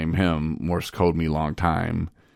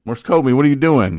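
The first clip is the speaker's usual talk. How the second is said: louder, higher mock challenge; startled call-out; 8 semitones higher, 9 dB louder than usual. Roommates to the man army crawling in, startled call-out. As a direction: call out someone caught in the act, loudly.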